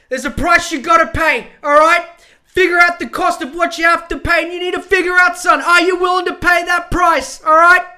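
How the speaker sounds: tough-love coach laying into him; hammed-up motivational rant, building from firm to shouting; yelled pep talk. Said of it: "giving him this big motivational talk".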